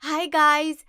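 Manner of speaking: loud, upbeat hello to followers; vlogger greeting. Acted. Wife opening a video for followers at Marine Drive, vlogger greeting. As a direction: project brightly to the phone camera.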